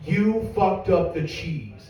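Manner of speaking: slow, deliberate blame in an even pitch; slow accusation. Acted. Customer confronts manager over burgers, slow accusation. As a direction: spell out the offence word by word.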